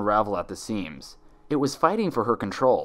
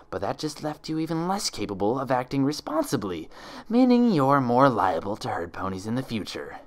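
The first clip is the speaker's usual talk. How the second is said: sneering impostor; 4 semitones higher than usual. Acted. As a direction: savour every word of the taunt. Impostor lecturing pinned Twilight.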